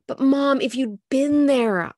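high, loud, urgent plea drawn out; pleading. Acted. Molly pleads with her exasperated Mom, high and insistent. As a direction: beg Mom to hear you out.

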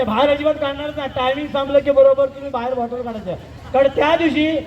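ordinary talk, nothing marked

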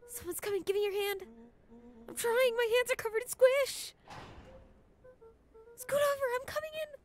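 high-pitched, frantic hurry; panicked urgency. Acted. Friends scrambling into a dumpster as someone comes, panicked. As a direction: blurt it high, desperate to hide.

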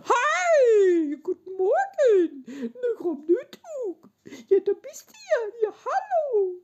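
very high, loud pet-talk voice with giggles. Shown as a demonstration. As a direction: coo at it like greeting a puppy. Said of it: "so redet man ja mit Tieren".

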